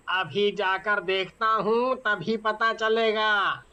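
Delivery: a tired village driver, slowed down; low unhurried driver voice; bus driver. Acted. Bus driver answers passengers.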